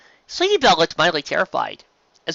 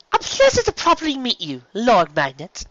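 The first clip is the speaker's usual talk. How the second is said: much higher, genteel pony lady voice; a gracious, refined greeting; courteous mare, a touch louder; polite mare; 9 semitones higher, 3 dB louder than usual. Acted. Verity greets the duke.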